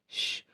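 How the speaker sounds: very soft, voiceless shush; hushing shush. A narrator's reading. Man hushing her nervous apology, a very soft shush. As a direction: hush someone gently, finger to the lips. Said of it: "तो तिच्या ओठांवर बोट ठेवत बोलला".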